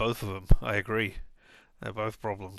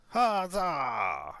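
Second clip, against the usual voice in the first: mock cheer; 6 semitones higher than usual. Cheering co-op gaming plan.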